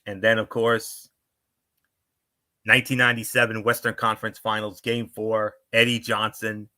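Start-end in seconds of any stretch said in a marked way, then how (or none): none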